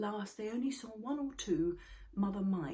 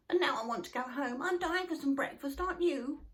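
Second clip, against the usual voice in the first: high, loud eager squeak; Sniff voice; 9 semitones higher, 5 dB louder than usual. A narrator's reading. Sniff hungry and wanting to go home, high squeak. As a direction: whine hungrily for breakfast. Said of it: "said Sniff".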